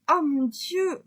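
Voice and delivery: cri d'effroi aigu et fort; elle découvre l'horreur, plus haut; horrifiée. A narrator's reading. Eva choquée découvre la scène. (Eva horrifiée, voix aiguë et forte)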